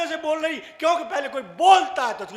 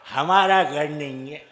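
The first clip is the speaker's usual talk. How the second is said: put-on low slurred drunk voice; dazed drunk; 7 semitones lower than usual. Drunk husband telling friend it's the wrong house, low slur. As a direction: mumble it like a beaten drunk.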